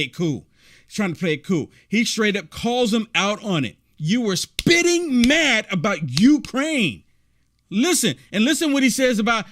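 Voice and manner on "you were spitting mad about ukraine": slow, drawn-out call-out; pointed quote, dragged out for emphasis; emphatic quoting. Retold or imitated. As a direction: stretch the words to drive the accusation home. Replaying host's call-out for emphasis.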